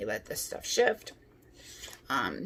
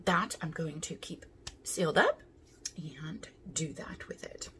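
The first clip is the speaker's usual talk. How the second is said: whispering. Real, not acted. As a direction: whisper it aside. Whispered aside about giveaway.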